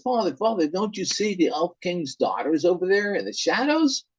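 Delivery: lightly voiced frightened boy; panicked son. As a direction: cry out to father in fear. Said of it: "And son says".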